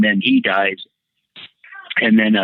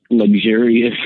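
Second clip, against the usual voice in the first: ordinary delivery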